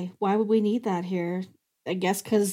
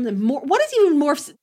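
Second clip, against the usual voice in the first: normal talking voice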